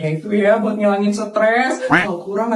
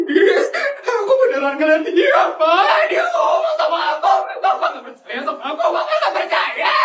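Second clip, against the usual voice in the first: tearful, much higher, breaking voice; crying plea; 10 semitones higher than usual. Acted. Feli insists to her father she saw Kayla, sobbing. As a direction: beg him to believe you through sobs.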